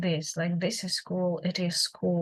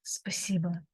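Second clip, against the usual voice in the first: спасибо whispered with a short neutral final vowel; whispered model; 5 dB quieter than usual. Shown as a demonstration. Teacher whispers the word for learners. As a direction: breathe спасибо softly, final O reduced. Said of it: "Same with the word спасибо".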